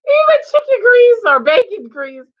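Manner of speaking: high squealing laugh through the words; cracking up at the chicken grease. Real, not acted. Laughing hard while telling friends about the show.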